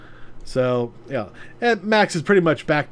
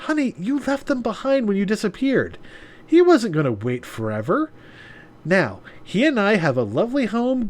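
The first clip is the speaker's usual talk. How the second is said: smug gloating; 7 semitones higher than usual. Acted. Yuna gloating to Maya.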